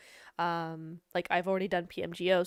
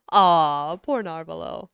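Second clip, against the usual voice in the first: mock sympathy; 4 semitones lower, 14 dB louder, slower than usual. Real, not acted. Teasing sympathy for Narvalo.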